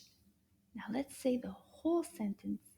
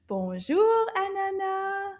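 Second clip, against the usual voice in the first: louder greeting; 8 semitones higher, 6 dB louder, slower than usual. Shown as a demonstration. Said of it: "Let's try it again".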